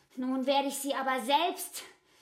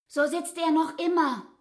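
ordinary talk

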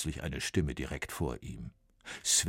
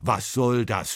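louder sharp rebuke; scolding; 5 semitones higher, 6 dB louder than usual. A narrator's reading. Bach scolds Sven over the crooked lines. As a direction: Bach snaps at the paper. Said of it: "schimpft Bach".

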